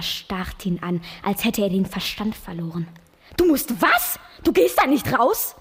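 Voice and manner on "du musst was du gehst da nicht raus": high, loud and commanding; the mother forbidding him in alarm; loud, high, stern motherly order; firm command. Acted. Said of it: "bestimmte sie".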